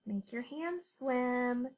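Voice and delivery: ordinary talk, nothing marked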